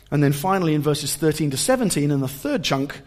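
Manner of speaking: ordinary talk, nothing marked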